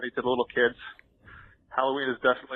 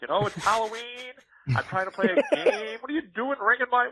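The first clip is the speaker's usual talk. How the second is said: higher, whiny mock exasperation; hammed-up pestered complaint; exasperated protest; 8 semitones higher than usual. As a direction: plead with pestering kids. Acting out pestered gamer.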